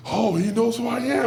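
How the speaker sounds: high, loud giddy squeal of recognition; starstruck excitement. Retold or imitated. Wrestler reliving being recognised by his idol, giddy excitement. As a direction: gush like a thrilled fan, laughing. Retold starstruck inner reaction.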